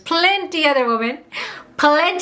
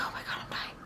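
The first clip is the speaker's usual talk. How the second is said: soft whispered panic; panicked whisper; 19 dB quieter than usual. Retold or imitated. Waiting on the phone for his answer, whispered panic.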